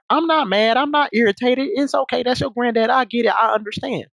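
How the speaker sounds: high, sing-song fake calm; mock calm reassurance. Retelling Maya's too-sweet reassurance, high and mock soothing. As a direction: mimic her saying it's okay, sweetly. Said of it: "she saying".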